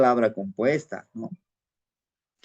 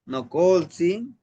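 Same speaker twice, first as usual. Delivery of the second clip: slow prayerful; 4 semitones higher, much slower than usual. Shown as a demonstration. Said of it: "Es parte de una plegaria".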